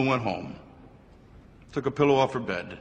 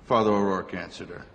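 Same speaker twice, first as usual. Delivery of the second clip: usual speaking voice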